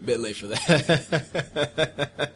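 slow creepy laughing reply. Acted. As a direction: laugh darkly through the words. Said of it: "That's creepy".

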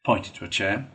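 usual speaking voice

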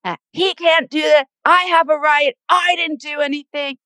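high, outraged imitation; mock the husband's outrage, high. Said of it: "he was like".

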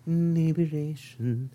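soft, slow, drawn-out word; mock tipsy. Host admitting his state, soft slow tipsy delivery. Mock-drunk pause before final story.

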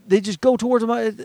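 ordinary delivery

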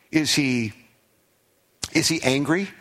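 ordinary talk, nothing marked

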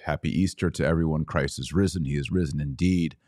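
usual speaking voice

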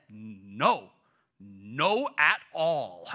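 put-on stubborn little kid, loud and drawn out; Aiden. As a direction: refuse bedtime slowly and emphatically. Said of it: "That was, like, Aiden's emphatic".

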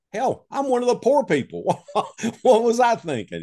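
high, amused disbelief breaking into laughter; laughing self-mockery. Real, not acted. Man realizing he was one of the poor, laughing. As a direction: crack up at your own blind spot.

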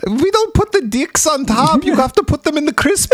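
hammed-up fussy complaint in a silly high voice; mock scolding character. Riffs as fridge's fussy owner.

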